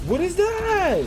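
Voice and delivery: high, loud astonished question; startled yell. Real, not acted. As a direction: yell it in surprise. Startled by game sight.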